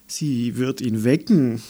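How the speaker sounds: ordinary delivery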